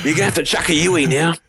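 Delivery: high, broad Aussie GPS voice; hammed-up extreme Australian accent; Steve Irwin. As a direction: give directions as an over-the-top Aussie. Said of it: "You do that so well".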